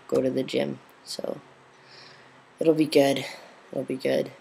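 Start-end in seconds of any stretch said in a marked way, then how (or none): none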